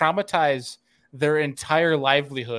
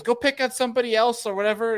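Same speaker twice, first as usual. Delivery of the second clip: high, fast whiny mock voice; mocking the dock owner; 8 semitones higher, faster than usual. Dock owner shooing fishermen away. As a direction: rattle it off like a grump. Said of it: "he goes".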